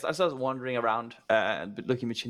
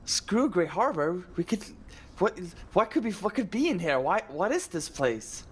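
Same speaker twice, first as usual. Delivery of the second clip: high, stretched-out marveling; excited wonder; 6 semitones higher, slower than usual. Acted. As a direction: wave off the plan, caught up in wonder. Ace too enthralled to leave.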